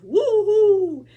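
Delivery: high, loud woo-hoo howl; barking dog. Acted. Daunted dog barking at the sneeze, loud. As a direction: bark it like a startled dog. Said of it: "who barked".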